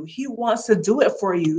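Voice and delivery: usual speaking voice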